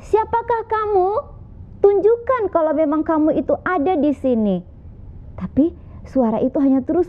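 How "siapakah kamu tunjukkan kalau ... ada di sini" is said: put-on high, insistent young man calling out; young man demanding. Young man calls to the unseen voice, high and insistent. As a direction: demand it high, as if searching the dark.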